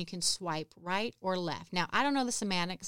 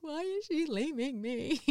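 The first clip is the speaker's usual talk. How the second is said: mock whiny wail; 7 semitones higher than usual. Alone in car, upset.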